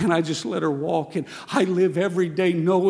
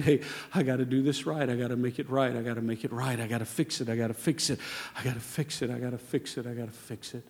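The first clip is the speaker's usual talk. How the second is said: each repeat softer, down to a murmur; fading repeated resolve; 6 semitones lower, 7 dB quieter, faster than usual. Retold or imitated. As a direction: chant it quieter and quieter.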